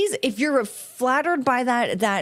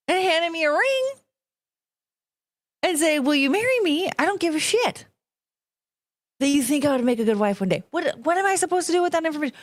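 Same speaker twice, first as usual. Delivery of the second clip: worked-up rant; 6 semitones higher than usual. Real, not acted. Ranting about marriage proposals.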